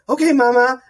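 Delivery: small son's reply. Retold or imitated. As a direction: lightly voice an obedient little boy.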